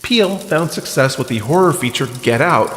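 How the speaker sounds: usual speaking voice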